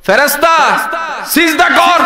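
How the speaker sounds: high, loud imperative shout; shouted command. Acted. God orders the angels to prostrate, shouted command.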